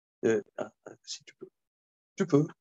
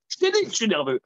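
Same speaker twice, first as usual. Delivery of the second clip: irritated insistence; 8 semitones higher, 4 dB louder, much faster than usual. Retold or imitated.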